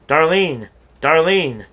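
high, loud call across a crowd; eager call across the station platform. Acted. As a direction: shout her name to be found. Family calling her at station.

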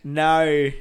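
loud Aussie "naur"; mock Australian. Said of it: "A U R".

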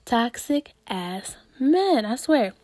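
ordinary talk

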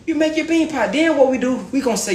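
ordinary delivery

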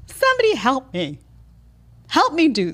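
high, pleading mock cry for help; helpless woman. Women stranded by the road, mock helpless plea. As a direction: ham up a stranded woman begging. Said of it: "and we're like".

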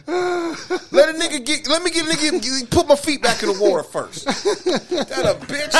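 laughing. Real, not acted. Laughing while pleading.

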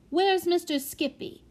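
put-on high, worried little-child voice; anxious. A narrator's reading. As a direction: ask it like an anxious small child. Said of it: "asked the prodigy anxiously".

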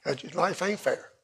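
quiet repeat. Acted. Repeats the line, second softer.

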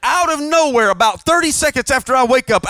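normal talking voice

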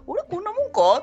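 puzzled quote. Retold or imitated. Quoting friend's puzzled reaction.

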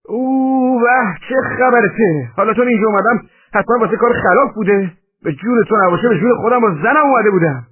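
high, loud outcry under attack; loud, high-pitched indignant pleading; shouted protest. A narrator's reading. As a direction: yell out in self-defence. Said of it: "فریاد زد و گفت".

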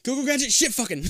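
high, hammed-up cartoon call; Gadget catchphrase. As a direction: ham up the gadget line. Gadget riff.

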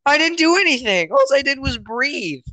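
put-on high-pitched, pleading kid's protest; hammed-up, wounded whine at a parent; exasperated protest. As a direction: plead innocence in mock outrage. Acted kid protesting to yelling parent.

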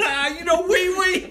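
very high, drawn-out silly bro voice; mock bro. Office bro naming another local pal, high and silly. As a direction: squeal it like an over-eager pal. Mocking the bros' local chat.

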